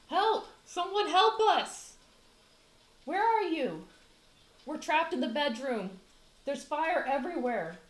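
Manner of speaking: high, loud panicked screams; screaming for help. Acted. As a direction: scream as if trapped in a burning house. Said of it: "hears the screaming".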